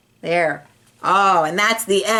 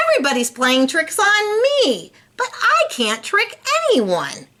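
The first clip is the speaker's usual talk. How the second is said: whiny complaint; 10 semitones higher than usual. Acted. Gilbert complaining, frustrated.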